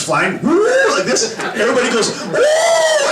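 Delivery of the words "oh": high laughing yelp of fright; mock scream. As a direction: yelp like a plane dropping. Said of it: "the whole airplane starts screaming".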